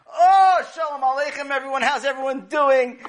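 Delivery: high, loud hearty welcome; a father breezing in all smiles; beaming loud hello to the family; cheerful greeting. Acted.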